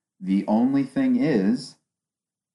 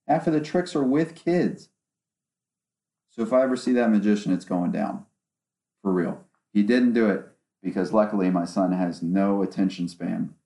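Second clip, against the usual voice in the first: ordinary talk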